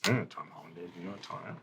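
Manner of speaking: low, hushed murmur. Acted. Lookouts confer behind the flashlights about Tom, murmured. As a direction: murmur it softly to a companion. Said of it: "There's some murmured conversation".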